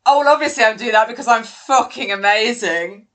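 loud mock self-importance; false grandiosity. Showing co-host the self-bashing humour, mock-grandiose. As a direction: puff it up as an obviously fake boast. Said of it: "the false pretense of grandiosity".

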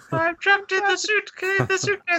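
very high squeaky little voice, pleading from inside a suitcase; tiny whispery voice. Retold or imitated. Retelling the trapped leprechaun's plea, very high squeaky voice. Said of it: "a little whispery voice".

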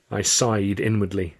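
usual speaking voice

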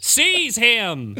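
high, loud order; fake deacon command. Fake deacon ordering his guards to seize the man, loud.

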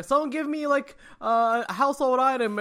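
comedian yelling. Acted. Improv comedian asking the audience for a household item, mock yelling. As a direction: call out to a crowd for suggestions.

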